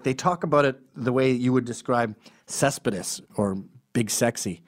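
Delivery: usual speaking voice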